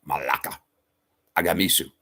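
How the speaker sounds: lightly put-on Greek accent, starting hushed; imitated Greek accent. Friend Chris answering the greeting, in a Greek accent. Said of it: "With his Greek accent".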